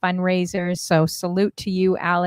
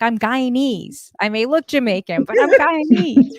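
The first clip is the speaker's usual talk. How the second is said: indignant friend; 6 semitones higher, 5 dB louder than usual. Retold or imitated. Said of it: "She's like".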